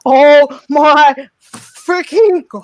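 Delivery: slow, loud, stunned cry of delight; real starstruck exclamation; excited disbelief. Real, not acted. Fan sees her idol join.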